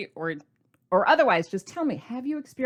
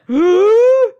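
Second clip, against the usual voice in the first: huge high gasp of shock; loud shocked gasp; 12 semitones higher, 15 dB louder than usual. Retold or imitated. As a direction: gasp as if hit by bad news. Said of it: "That was so dramatic with my screaming".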